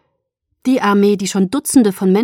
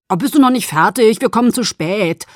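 loud, nervous calling out; edgy, hurried shout to someone in another room; 3 semitones higher, 6 dB louder than usual. A narrator's reading. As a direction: call out impatiently, worried about time. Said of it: "Charlie klang nervös".

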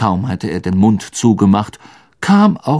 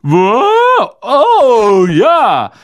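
high, loud, flustered character voice; caught off guard; 12 semitones higher, 7 dB louder, much slower than usual. Acted. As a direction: blurt it loudly in a high voice. Ron caught off guard as Crabbe.

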